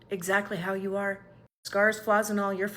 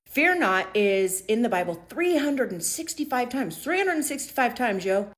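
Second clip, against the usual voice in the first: emphatic; 6 semitones higher, 6 dB louder than usual. Real, not acted. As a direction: drive the point home with excitement. Emphatic repeat of key fact.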